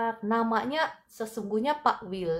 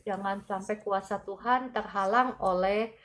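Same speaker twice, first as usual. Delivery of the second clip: fervent healing prayer. Acted. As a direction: pray with rising force, pressing in. Healing prayer over a viewer. (doa penyembuhan yang berapi-api)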